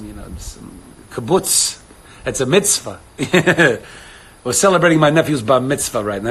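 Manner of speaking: Yiddish-flavoured New York accent. Shown as a demonstration.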